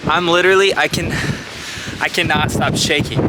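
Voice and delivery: shaky, breathless, scared climbing; panting shaky eyewitness. Real, not acted. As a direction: voice shaking with real fear up high. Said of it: "God, I'm shaking".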